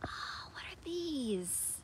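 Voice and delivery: slow, softened, breath-filled amazement; hushed wonder. Pretend. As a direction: marvel at it slowly, half whispering.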